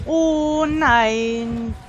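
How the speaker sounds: loud drawn-out dismay; lautes entsetztes Oh nein. Real, not acted. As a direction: wail it in horror. Teammate caught, dismay. (lautes, entsetztes Stöhnen)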